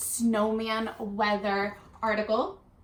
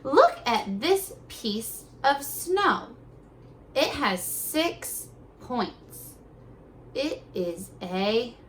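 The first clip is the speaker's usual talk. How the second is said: very slow reading; slow read-aloud; 2 semitones higher, much slower than usual. Shown as a demonstration. Teacher reading the article slowly for kids to follow. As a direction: read it word by word so they follow. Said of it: "follow along as I read".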